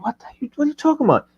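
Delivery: high, fast, baffled what-the; baffled retort. Retold or imitated. Retelling his baffled reply to his friend. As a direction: shoot back in disbelief. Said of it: "And I was like".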